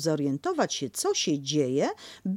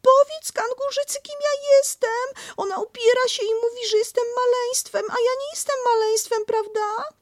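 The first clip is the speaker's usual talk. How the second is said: much higher, louder hurried squeak; indignant little pig; Piglet pleading; 5 dB louder than usual. Acted. Piglet begging Christopher, much higher.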